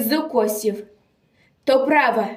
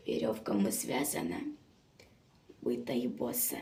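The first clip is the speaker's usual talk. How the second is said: very low, barely voiced murmur; grave hush; 13 semitones lower, 16 dB quieter, faster than usual. A narrator's reading. Reciter describes the bound, beaten girl, grave hush. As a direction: sink to a near-silent, low aside.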